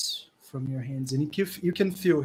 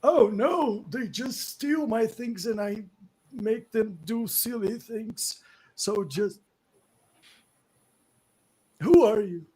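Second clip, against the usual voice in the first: put-on higher, louder old man complaining; 7 semitones higher, 6 dB louder than usual. Old man complaining to the party about goblins. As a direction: grumble like a fussy old man.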